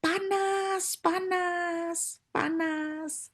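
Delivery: a demon burning and wailing; high demon wail of pain; demon shrieking. Retold or imitated. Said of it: "tiba-tiba teriak".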